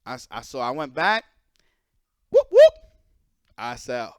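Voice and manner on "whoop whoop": very high, loud whooping police siren; arriving police car, siren noise; siren imitation. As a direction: mimic a cop car siren. Police pulling up.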